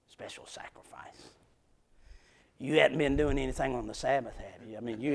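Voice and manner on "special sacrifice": scornful whispered echo; mocking whisper. Preacher mocking the priests' words, whispered.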